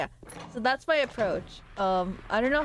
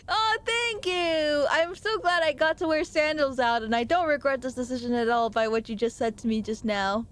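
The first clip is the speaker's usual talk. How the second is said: gushing mock gratitude; 4 semitones higher than usual. Thanking someone for a toe compliment, mock gushing. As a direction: gush with hammed-up delight, then ease down. Acts reply to an odd compliment.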